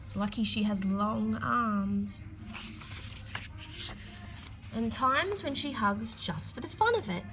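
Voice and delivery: ordinary delivery, nothing marked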